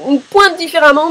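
ordinary talk, nothing marked

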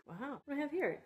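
ordinary delivery

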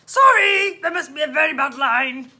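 loud shout over a bad line; booming officer calling out; policeman. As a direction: shout it down the phone.

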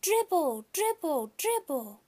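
high chanted shout, easing off; high-pitched kiddie shouting; kids shouting. A narrator's reading. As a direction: chant it like a pack of small children. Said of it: "they started to shout".

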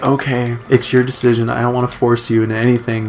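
hushed retold concession; imitated resigned teacher. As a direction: back off quietly, as if hurt. Voices teacher giving in after crying.

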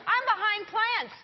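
loud, teasing announcement to everybody; playful calling out. Pretend. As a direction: holler to the room while hidden. Calling from hiding among plants.

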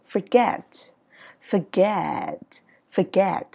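"Forget" with a long gliding "get"; English sliding intonation. Shown as a demonstration. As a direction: slide "get" up and down smoothly. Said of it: "Là có trượt lên". (đọc "Forget" trượt lên, trượt xuống)

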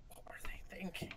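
hushed whisper. Real, not acted. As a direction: whisper it under your breath. Hushed aside at the silly Splatfest.

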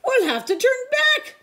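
high, loud cry; panicked yell. A narrator's reading. Pirate panics after the mast splits: loud yell. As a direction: shout it over the storm. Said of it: "called another".

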